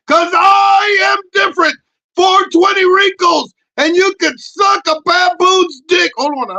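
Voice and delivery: high, loud puppet shouting a defiant rant. Acted. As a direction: rant as the cocky pep puppet.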